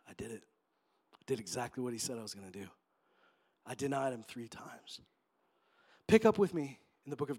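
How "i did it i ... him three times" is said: low, very soft confession; hushed realization. Acted. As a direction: let it sink in, quietly and low. Peter realizes he denied Jesus.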